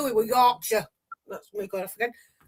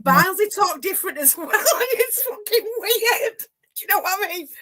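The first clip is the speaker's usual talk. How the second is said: breaks into real laughter mid-sentence; can't hold it together over the Barnsley talk; laughing; 8 semitones higher than usual. Real, not acted. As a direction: laugh through the words, high and giggly.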